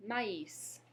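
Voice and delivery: maïs in two syllables, ma-is, s said; maïs, the a and i apart; French maïs. Shown as a demonstration. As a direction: model maïs. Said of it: "The first is".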